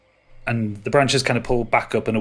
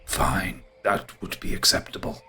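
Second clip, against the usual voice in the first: grudging, low and softer; grumbling ent; 4 semitones lower, 6 dB quieter than usual. Acted. Ent reluctantly agreeing to a feast, grumbling. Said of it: "huffs and grumbles and goes".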